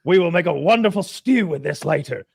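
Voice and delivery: high, grandly pleased Englishman; heartily over-acted thanks for a gift; Englishman's hearty proclamation. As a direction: proclaim it like a gracious host. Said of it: "and he's like".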